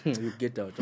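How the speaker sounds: usual speaking voice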